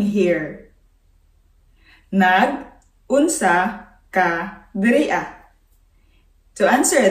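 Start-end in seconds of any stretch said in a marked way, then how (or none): 2.1-5.4 slow for learners. Shown as a demonstration. Slow repeat for learners to copy.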